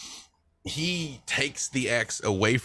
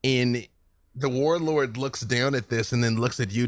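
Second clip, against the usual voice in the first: normal talking voice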